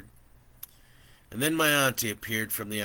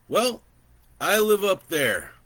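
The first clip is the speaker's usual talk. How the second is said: put-on higher, louder, friendly old rancher; an old man chatting easily from horseback; gentle old landowner welcoming a stranger; kindly old man; 10 semitones higher, 9 dB louder than usual.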